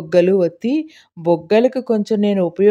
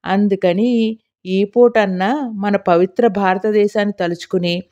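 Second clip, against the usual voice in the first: ordinary delivery, nothing marked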